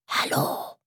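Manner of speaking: a voiceless, breathy whisper of Hallo; geflüstert. Acted. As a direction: whisper it into the silent kitchen. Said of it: "flüsterte Mumin". (geflüstertes Hallo)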